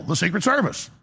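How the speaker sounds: ordinary delivery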